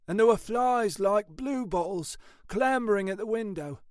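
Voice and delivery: high-pitched police colleague giving a report; animated, high-voiced officer; Ashford's character voice.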